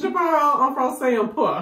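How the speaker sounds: French. Shown as a demonstration. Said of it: "I only speak a little bit of French".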